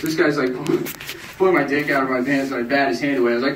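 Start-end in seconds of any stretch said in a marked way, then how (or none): none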